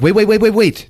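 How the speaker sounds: high, loud string of repeated words; urgent interjection. Retold or imitated. Joan stops the freaked-out Annie, urgently. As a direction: cut in urgently, rapid-fire and high.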